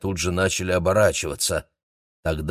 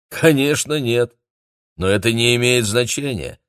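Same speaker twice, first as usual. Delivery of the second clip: higher, amused dismissal; wry amusement; 5 semitones higher, 4 dB louder than usual. A narrator's reading. Uncle answers whether he believes the legend, wryly amused. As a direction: brush it off with a light smirk. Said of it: "усмехнулся дядюшка Дымин".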